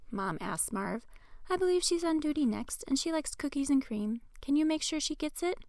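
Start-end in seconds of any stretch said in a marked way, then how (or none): 1.5-5.6 high-pitched mother asking a favor; chatty mom voice, high and kindly; friendly, helpful mom. A narrator's reading. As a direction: lift the pitch well up for a sociable mom.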